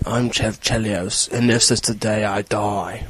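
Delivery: low, soft, slow British tough-guy mumble; gruff action hero impression. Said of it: "I think I just got a new impression".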